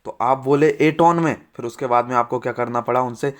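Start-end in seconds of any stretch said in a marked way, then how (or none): 0.2-1.4 louder, slowed, mock quarrelsome demo. Shown as a demonstration. Teacher demonstrating how you snap at your wife, mock quarrelsome. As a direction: lean on it like a half-played spat. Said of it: "झगड़ालू type की आवाज़ में".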